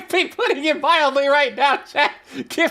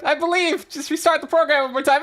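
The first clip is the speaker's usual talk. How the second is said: usual speaking voice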